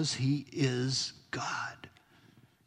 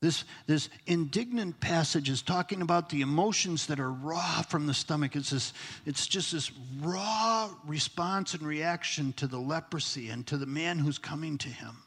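normal talking voice

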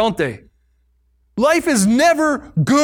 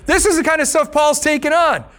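ordinary talk, nothing marked

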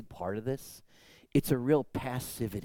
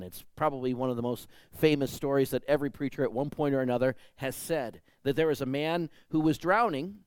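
ordinary talk

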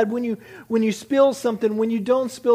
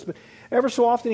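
ordinary talk

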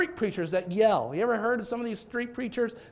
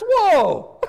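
excited whoa; 10 semitones higher, 11 dB louder than usual. Real, not acted. Pastor reacting to his own yell.

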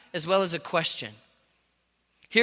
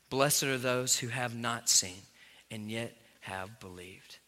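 soft, slow Bible reading; quiet scripture read; 8 semitones lower, 8 dB quieter than usual. Acted. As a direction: read the verses hushed and reverent.

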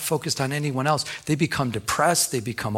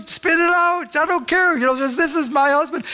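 high, loud insistent wife; unabashed urgent shout; 5 dB louder than usual. Retold or imitated. Wife protecting sick-prone husband in public, unabashed urgency. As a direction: imitate a wife urgently not caring who hears. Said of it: "She could care less who heard it".

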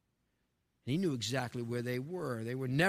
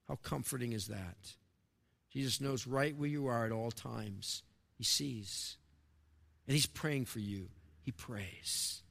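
softer, lower, tender reassurance; gentle softer preacher's hush; 5 semitones lower, 6 dB quieter than usual. Real, not acted. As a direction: lean in and comfort them quietly. Quiet tender aside to listeners.

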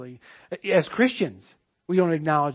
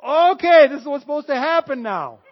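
much higher, louder, overexcited; mock triumphant; 9 semitones higher, 13 dB louder than usual. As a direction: sure the miracle is coming. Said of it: "That's what I was like".

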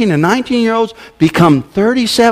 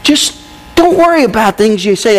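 usual speaking voice

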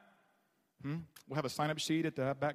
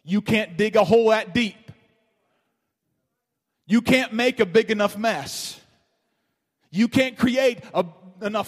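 loud, high proclamation; full-force preaching; 5 semitones higher, 15 dB louder than usual. Real, not acted. Preacher shouting hope to his congregation, loud and high. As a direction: preach it out to the back row.

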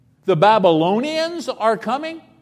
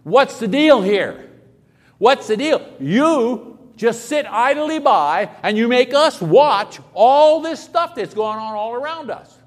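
brash complaint; 4 semitones higher, 6 dB louder than usual. Retold or imitated. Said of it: "I can see going to God and saying".

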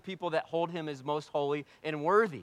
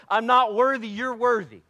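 impassioned cry; 7 semitones higher, 9 dB louder than usual. Retold or imitated. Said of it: "does is fall down and goes".

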